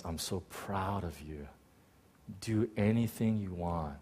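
very soft, hushed and loving; tender fatherly pride. Retold or imitated. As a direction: give quiet, heartfelt approval to a son. God speaks tenderly to him.

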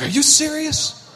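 high, squeaky disbelief; hammed-up gasping disbelief; mock shock. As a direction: play it as over-the-top amazed. Acted shocked reply in joke.

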